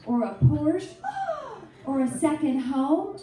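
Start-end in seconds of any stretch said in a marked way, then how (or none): 1.0-1.6 high gasp of pity; mock horrified gasp. Greenwich party guest, mock horrified gasp. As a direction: gasp at the missing Porsche.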